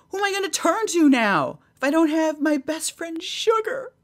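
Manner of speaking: high-pitched hammed-up plea; mock desperation. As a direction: plead up high as if bereft. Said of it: "I started sweating and panicking".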